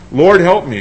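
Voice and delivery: loud pleading cry; begging. A narrator's reading. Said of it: "She's begging, she's pleading with him".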